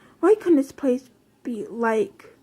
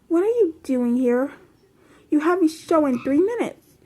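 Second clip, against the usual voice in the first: hurried tone; 4 semitones higher than usual. A narrator's reading. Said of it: "she said in a hurried tone".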